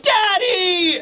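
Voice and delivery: put-on a child screaming for her father; crying shout. Bonnie calls out to her father, high tearful shout.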